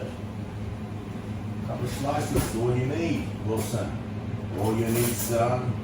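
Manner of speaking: slow, soft coaxing to the dog; tender coaxing. Real, not acted. Owner gently coaxing his dog Wilson about peanut butter.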